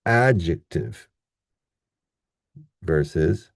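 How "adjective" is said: "adjective" slow, with a strong AD; stress on first syllable. Shown as a demonstration. Teacher contrasting two words, slow first-syllable stress on "adjective". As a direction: hit AD in "adjective", reduce the rest. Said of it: "very different than the previous one".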